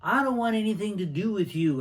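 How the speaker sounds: mock repulsed I don't want anything; repulsed rejection. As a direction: shove them off with your voice. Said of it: "get repulsed".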